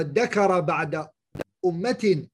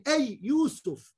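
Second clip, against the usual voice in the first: drawn-out call; 7 semitones higher, much slower than usual. Shown as a demonstration. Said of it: "Or you could say he's saying".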